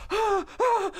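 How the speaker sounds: very high squeaky panicked gasps; shrill mock panic; panicked gasping cry. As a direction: gasp awake in terror. Guy wakes up mid-air.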